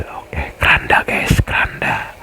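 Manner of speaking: hushed whisper to the viewers; quiet whispered aside. Real, not acted. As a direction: breathe it out, unvoiced. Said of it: "whispers to viewers".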